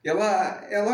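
usual speaking voice

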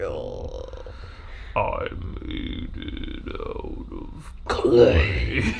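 very low, slow, breathy creepy voice; a creepy kids' show ghost, slow and whispered; creepy children's drama. Pretend. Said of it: "slow, creepy children's drama".

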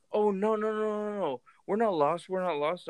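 imitated high-pitched, insistent denial; hasty denial. Strangers tell a homeowner they're not lost, imitated high denial.

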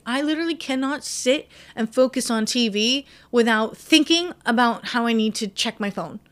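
ordinary delivery

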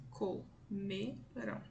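"Comeram" very slowly in three separate pieces, co-me-ram; syllable by syllable. Shown as a demonstration. Teacher counting three syllables, "Comeram" split slowly into co, me, ram. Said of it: "tem três pedacinhos, três sílabas".

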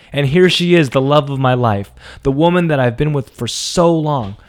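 ordinary talk, nothing marked

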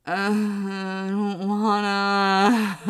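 slow, whiny I don't wanna; reluctant, stretched-out childish whine; whiny reluctance. Retold or imitated. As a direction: drag it out like a sulky kid. Reluctant reply to mom.

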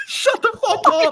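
high squealing laughter through words; cracking up mid-sentence; laughing hard. Real, not acted. Group bursting out laughing.

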